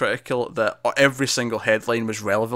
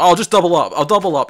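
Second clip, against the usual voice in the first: louder, higher, caffeinated enthusiasm; mock-eager and pitched up; eager retold offer; 5 semitones higher, 6 dB louder than usual. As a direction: blurt it out keenly as an imitation. Acting out over-caffeinated character's eager offer.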